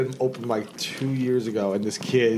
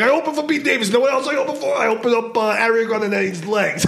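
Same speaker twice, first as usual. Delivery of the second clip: mock cocky comic; 7 semitones higher than usual. Imitating a bragging young comic.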